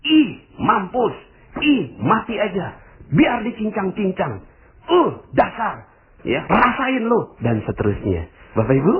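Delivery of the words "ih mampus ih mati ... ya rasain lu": high, mocking jeer at the TV; gloating curse. Viewer gloating at a corrupt official on TV, high spiteful jeer. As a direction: spit it out with spiteful glee. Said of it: "Anda katakan begini".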